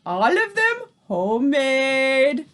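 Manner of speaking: sly, leering witch punchline; fiendish leer. A narrator's reading. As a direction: drop the joke with a wicked grin. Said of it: "she added with a fiendish leer".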